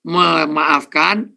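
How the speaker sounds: very slow, stretched memaafkan; drawn-out emphasis. Real, not acted. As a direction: draw out memaafkan syllable by syllable. Counts the steps, stretched word.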